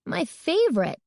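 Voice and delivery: high, swooping delight; excited high-pitched exclamation. A narrator's reading. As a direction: gush over a favorite treat. Girl delighted by her candy.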